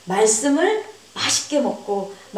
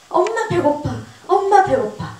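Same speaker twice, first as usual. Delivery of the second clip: put-on child's whine; 6 semitones higher than usual. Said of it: "우리 성은이가 건강하면요".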